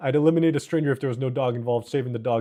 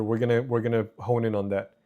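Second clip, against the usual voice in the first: normal talking voice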